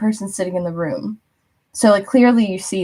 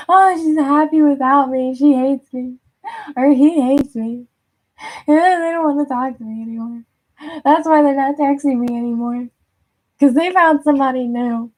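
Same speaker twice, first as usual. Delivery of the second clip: mock insecure fretting; 6 semitones higher than usual. The person worrying you've moved on, higher hammed-up fretting. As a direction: play a jealous ex spiralling, half joking.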